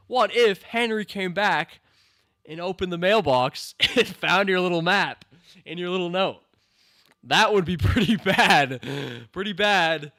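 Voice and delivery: loud scolding rant; loud exasperated rant. Real, not acted. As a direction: tell Max off at full volume. Ranting at character's bad plan.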